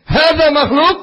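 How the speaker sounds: sharp high angry question. Retold or imitated. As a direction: demand it angrily as a question. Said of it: "intonasinya pertanyaan dan marah". (pertanyaan marah, nada tinggi)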